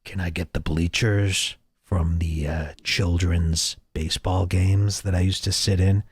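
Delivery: low creepy requester voice; Anthony Cumia. Acted. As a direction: drop low, mock a creepy man asking a favour. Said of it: "requested by Cumia himself".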